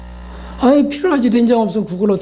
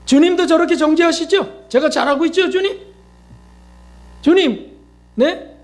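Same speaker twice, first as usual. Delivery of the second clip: high, wheedling plea to the Lord; whiny self-righteous plea; 7 semitones higher than usual. Retold or imitated. Self-righteous believer begging the Lord to punish others, high whiny plea. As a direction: coax God to side with you, voice pitched way up. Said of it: "좀 성령님께 물어보세요".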